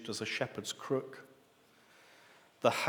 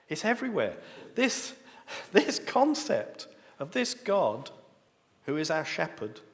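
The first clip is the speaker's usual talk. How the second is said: a preacher's awed high cry; rising excitement; 5 semitones higher, 3 dB louder than usual. Real, not acted. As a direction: let the discovery lift your voice up high.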